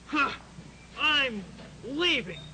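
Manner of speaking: usual speaking voice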